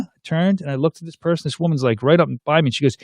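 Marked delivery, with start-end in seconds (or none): none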